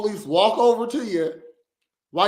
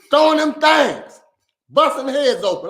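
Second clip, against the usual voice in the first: worked-up shouting; 6 semitones higher, 8 dB louder than usual. Real, not acted. Viewer worked up over the fight.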